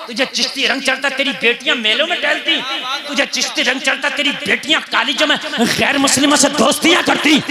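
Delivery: ordinary talk, nothing marked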